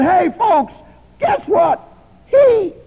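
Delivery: half-laughing jeer at him; mocking laughing girlfriend. Girlfriend mocks him to the party, high laughing jeer. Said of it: "then she had to laugh".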